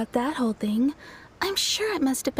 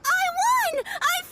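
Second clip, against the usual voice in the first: gleeful yelling; slower than usual. Retold or imitated. Retelling how Ito cheered after beating a kid, high gleeful yells. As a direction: crow over a win like a giddy kid. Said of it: "he started jumping around and yelling".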